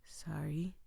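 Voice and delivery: whispered sheepish apology; a soft whispered apology; whispered sorry. Acted. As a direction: breathe the word like a guilty stranger. Man apologizes, voiced by reader.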